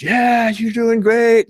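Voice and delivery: high, drawn-out cheering yell; yelling encouragement. Retold or imitated. Jeep driver cheering on a runner, yelling. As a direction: holler it loud and slow, way up high. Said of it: "he's yelling".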